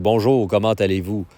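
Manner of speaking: everyday Quebec French greeting; Quebecois accent. Shown as a demonstration. Montreal local showing how we talk, in Quebecois accent. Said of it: "We're Quebecois. We talk like".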